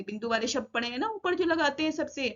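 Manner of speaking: normal talking voice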